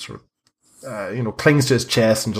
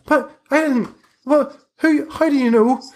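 very high, startled voice; panicked boy; 3 dB louder than usual. Acted. Boy caught out by his questioner, panicked. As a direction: squeal out a flustered denial.

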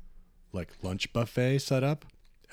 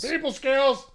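much higher squeaky repeat; 6 dB louder, slower than usual. Real, not acted. Repeating the brag.

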